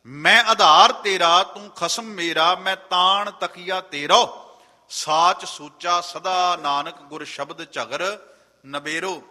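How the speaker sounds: slow, emphatic verse recitation, strong opening; scripture recitation. Real, not acted. Preacher reciting the closing verse to the congregation, slow scripture recitation. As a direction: draw the verse out, starting loud.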